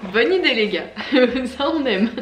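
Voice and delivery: laughing while speaking. Real, not acted. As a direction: giggle through it. Laughing at Smile Club logo.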